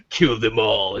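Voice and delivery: low, put-on masked villain; voice inside a mask. Playing a Sith in a mask, low masked voice. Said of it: "and sighted the mask".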